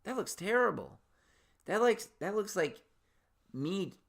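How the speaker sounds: mock horror. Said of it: "and I'm like".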